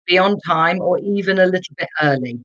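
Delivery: normal talking voice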